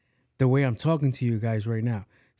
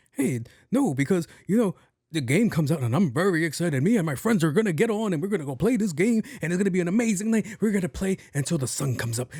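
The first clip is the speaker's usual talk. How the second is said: high, sing-song gushing gamer impression; antsy excited gamer; 5 semitones higher than usual. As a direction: imitate an over-eager gamer rattling off plans. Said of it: "like this. He's really like".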